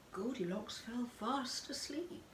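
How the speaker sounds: usual speaking voice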